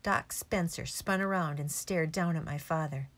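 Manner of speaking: plain reading voice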